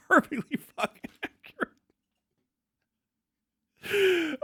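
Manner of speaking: "Perfectly fucking accurate" said high through laughter; laughing emphasis. Real, not acted. Streamer repeating his own joke, cracking up. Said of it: "perfectly accurate".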